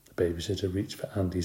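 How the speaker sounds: usual speaking voice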